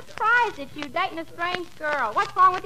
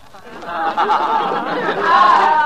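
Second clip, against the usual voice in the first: loud laugh; 11 semitones lower, 6 dB louder than usual. Real, not acted. [name] laughs at Pedro's joke.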